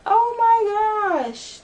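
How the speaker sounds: drawn-out, high, loud groan of pain; pained exclamation. Real, not acted. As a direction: stretch it out while it still stings. Reacting to the broken nail.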